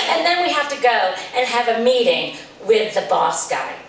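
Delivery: ordinary talk, nothing marked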